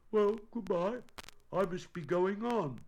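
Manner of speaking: high bear voice; departing bear. Acted. Pooh bids farewell, voiced in story.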